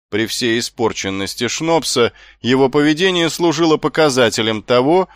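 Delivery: plain reading voice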